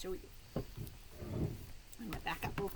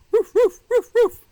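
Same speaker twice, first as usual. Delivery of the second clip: much higher and louder barks; dog barking; 13 semitones higher, 21 dB louder than usual. Pretend. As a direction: bark like an excited little dog. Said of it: "Can you bark?".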